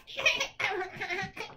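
high, choking cackle; hideous cackling laugh. A narrator's reading. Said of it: "hideous cackling laugh".

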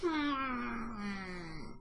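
long, soft falling hum; pondering hmm. A narrator's reading. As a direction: hum it quietly to yourself. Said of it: "the fox said to himself".

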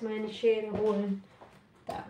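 ordinary delivery

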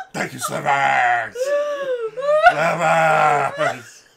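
slow loud bear bellow; bear shout. Acted. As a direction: roar the thanks as the bear. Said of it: "making Ruel ruin his voice".

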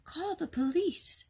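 high-pitched storybook shout. A narrator's reading. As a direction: call it out, pitch jumping up. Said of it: "shouted Mister Frizzle".

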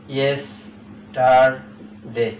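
"Yesterday" split into three slow, separate syllables. Shown as a demonstration. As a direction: say "yesterday" as yes, টার, ডে with clear gaps. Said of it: "তিনটা করে syllable থাকবে".

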